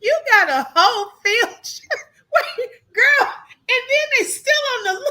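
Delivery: words pushed out through high laughter; can't stop laughing mid-sentence; laughing while talking. Real, not acted. Telling viewers the escape news, laughing hard.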